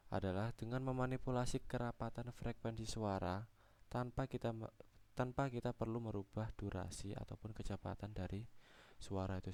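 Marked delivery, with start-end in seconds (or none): none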